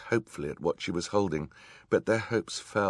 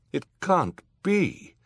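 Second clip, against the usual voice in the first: high, loud, slow shock; gasped disbelief; 13 semitones higher, 7 dB louder, slower than usual. A narrator's reading. Judge learning her colleagues are villains, aghast gasp. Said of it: "Justice Strauss gasped".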